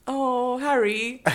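high, loud mock-British; hammed-up British film character; playful put-on British cry; British accent. Trading Harry Potter accent impressions.

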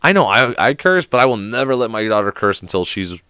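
ordinary talk

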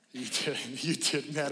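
ordinary talk, nothing marked

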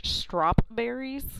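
"strawberries" said as strop-berries; slow "strawberries" with a P slipped in before the b; added P. Shown as a demonstration. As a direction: joke pronunciation strop-berries. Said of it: "there's a silent P in strawberries".

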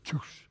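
soft, airy whoosh; quick hushed rush of a storm vanishing; imitated whoosh. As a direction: make a quiet whooshing noise. Imitates the storm suddenly stopping.

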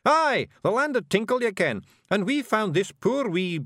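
high, loud Scots improvising; Scottish Feegle, bluffing. A narrator's reading. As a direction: seize the idea and bluster on. Voices Rob improvising.

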